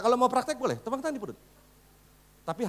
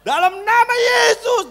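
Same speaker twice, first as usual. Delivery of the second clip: slow, very loud and high rebuke; slurred shouting rebuke; unclear shout; 14 dB louder, slower than usual. Shown as a demonstration. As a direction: stretch it into a loud blurred yell. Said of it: "Nggak jelas, saudaraku".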